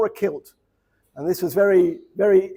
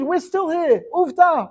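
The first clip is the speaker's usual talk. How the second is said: ordinary delivery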